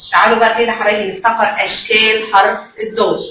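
ordinary talk

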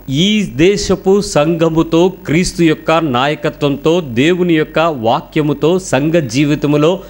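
very slow, drawn-out phrases; deliberate emphasis. Real, not acted. Preacher urging young people, slow and deliberate. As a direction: spell out each phrase slowly.

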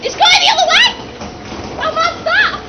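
excited. Real, not acted. Ride reverses.

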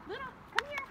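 high, coaxing call to a dog; high-pitched dog call. Real, not acted. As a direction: coax the dog over, voice way up.

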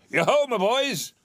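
high, loud, hearty call; jovial hail. A narrator's reading. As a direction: hail the lads like a merry old boss. Fezziwig hails his apprentices.

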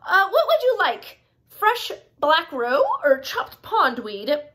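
chirpy storybook host taking an order; Happy Frog. A narrator's reading. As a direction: voice an eager frog waitress. Voices the frog offering food.

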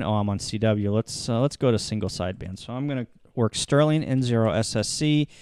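ordinary talk, nothing marked

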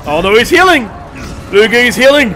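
high, loud cry of alarm; urgent gamer alarm; alarmed warning. Real, not acted. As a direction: call out the danger to your fighter. Enemy heals during the fight.

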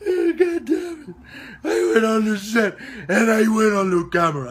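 high, loud laughter through the words; laughing. Real, not acted. Man telling his story of the film set, laughing. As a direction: crack up while telling it, voice shooting up.

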